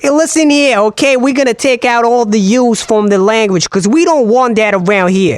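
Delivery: hammed-up, high, insistent wise-guy voice; Joey Wheeler. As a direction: lay down the law in a put-on dialect. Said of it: "like Joey Wheeler".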